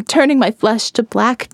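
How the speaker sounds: usual speaking voice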